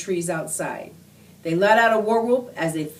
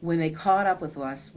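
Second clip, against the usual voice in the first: ordinary delivery, nothing marked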